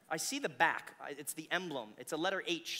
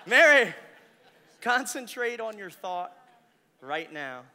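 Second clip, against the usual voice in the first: intense mind reader; 3 semitones higher, 14 dB louder, slower than usual. Real, not acted. Mind reader commands focus.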